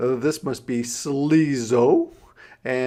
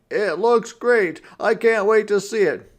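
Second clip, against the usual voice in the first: excited cry; 10 semitones higher, 7 dB louder than usual. A narrator's reading. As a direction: cry it out, bursting with joy. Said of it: "he cried".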